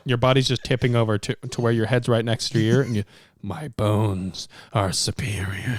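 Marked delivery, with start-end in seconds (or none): 3.4-5.8 slow, lower, drawn-out pronouncement; mock-solemn low boast; mock-heroic boast. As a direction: stretch it out like a pompous hero. Mock hero whispering by ear.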